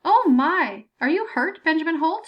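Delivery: lightly voiced old lady fussing over him; an old woman peering into the pit; old woman grader. A narrator's reading. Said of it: "An old woman looked down at him".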